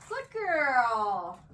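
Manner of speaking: reward her with your voice; loud praise. Real, not acted. Dog holds stay.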